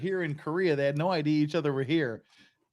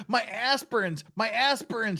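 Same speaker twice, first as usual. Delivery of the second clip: higher-pitched cry of alarm, lightly hammed; mock yell; 7 semitones higher than usual. Woman calling from a departing bus, mock yell. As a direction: call out after something left behind. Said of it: "she yells".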